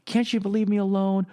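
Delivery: high-pitched exasperated outburst, re-enacted; retold yelling. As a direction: act out yelling at your father, pitch jumping up. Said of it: "just yelling at him".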